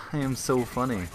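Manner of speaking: dry mock self-praise; deadpan sarcastic boast. Real, not acted. Gamer joking to viewers about a comedy career, sarcastic. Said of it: "didn't catch the sarcasm".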